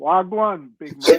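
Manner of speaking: playful Bermudian street greeting; Bermudian accent. Pretend. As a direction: throw out a local Bermudian greeting. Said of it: "I wanna hear it".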